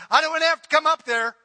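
loud quick parent threat; mock parent yell. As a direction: bellow it up the stairs. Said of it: "parents go".

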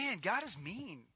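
soft, low grumbling thought; muttered doubt; quiet inner thought. Retold or imitated. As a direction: mutter it like a private complaint. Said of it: "read the Old Testament and think".

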